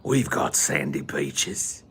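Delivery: slow, lightly put-on British voice; British accent. Quoting a film in a mock British accent.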